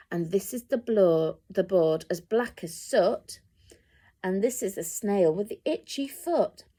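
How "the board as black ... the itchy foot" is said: ordinary delivery, nothing marked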